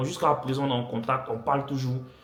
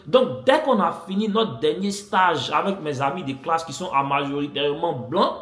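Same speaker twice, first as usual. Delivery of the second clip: ordinary delivery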